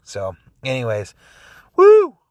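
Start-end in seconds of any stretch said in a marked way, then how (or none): 1.8-2.2 very high, loud cheer; excited whoop. Real, not acted. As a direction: let out a big celebratory whoop. Cheering at end of rant.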